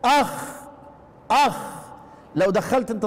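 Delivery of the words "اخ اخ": a raspy اخ scraped at the back of the tongue; khaa sound demo. Shown as a demonstration. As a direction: show اخ with friction behind the tongue. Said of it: "والخاء ايضًا".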